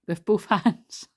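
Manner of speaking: breaking into laughter mid-phrase; real laugh bursting through the words. Real, not acted. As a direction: crack up as you read it out. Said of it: "I'm just laughing".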